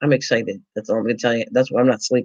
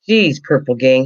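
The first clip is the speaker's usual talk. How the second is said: ordinary talk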